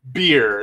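"beer" low, with an ee vowel; German beer. Shown as a demonstration. Joking that everything sounds alike, "beer" with an ee sound. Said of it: "Everything sounds like".

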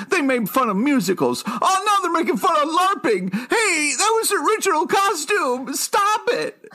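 very high, whiny sensitive guy; hammed-up oversensitive fan protesting; Alex impression. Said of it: "the sensitive guy who's like".

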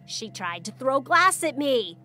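loud, drawn-out accusation; crying out. A narrator's reading. Stepmother accusing the girl to her father, crying out. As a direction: call it out slowly and loudly in alarm. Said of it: "looked at him and cried".

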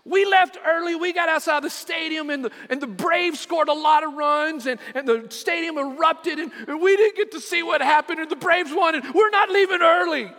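high, loud mock outburst building to the end; angry daughter shouting. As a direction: act out the daughter yelling her case. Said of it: "she's getting angry".

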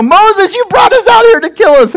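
very high-pitched mock wail; hammed-up high cry of protest; crying complaint. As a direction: whine it out like a panicked, accusing crowd. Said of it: "children of Israel is crying to Moses".

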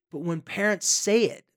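ordinary talk

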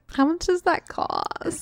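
high, slow puzzled voice; mock confused. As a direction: act baffled by the missing price. Mock confused customer quote.

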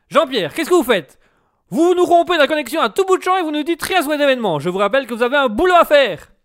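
loud, fast scolding. Acted. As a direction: tell him off on air. Host scolds the reporter.

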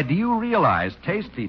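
ordinary talk

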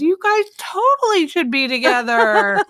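high, breathless mock gushing. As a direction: gush like a lovestruck fan. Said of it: "what did he expect her to go".

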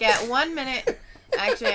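usual speaking voice

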